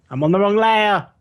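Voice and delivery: loud, high exclamation; exclaiming. Real, not acted. Game master spots own mistake.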